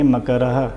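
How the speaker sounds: मकरः ending in a clear "ha"; sounded visarga. Shown as a demonstration. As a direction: pronounce मकरः as "makara-ha". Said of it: "विसर्ग के लिए ह की ध्वनि निकलेगी".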